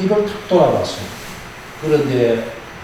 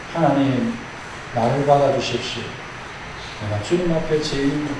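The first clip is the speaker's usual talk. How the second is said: humble prayer; 4 semitones lower, 7 dB quieter than usual. Shown as a demonstration. Modeled prayer of repentance.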